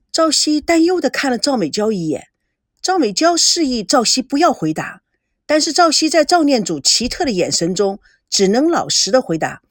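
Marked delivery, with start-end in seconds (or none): none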